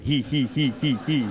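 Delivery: imitated mischievous old-man chuckle; evenly pulsed "hee-hee" snicker; mock giggle of a naughty old codger; old codger's giggle.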